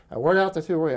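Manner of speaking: imitating an officer grilling a cadet; barking interrogator. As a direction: fire the question at the cadet. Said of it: "they all asked me questions like".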